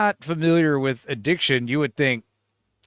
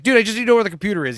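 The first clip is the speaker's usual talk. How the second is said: rushed plea; 3 semitones higher, much faster than usual. Retold or imitated. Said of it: "He's like".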